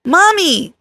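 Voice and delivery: put-on high, eager toddler calling out; little boy voice. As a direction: squeal it like a happy little kid. Toddler calls mom on phone.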